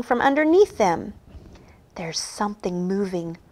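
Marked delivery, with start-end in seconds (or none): 2.0-3.4 hushed, very soft warning; whispered. A narrator's reading. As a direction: whisper it as a wary secret. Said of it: "whispered Percy".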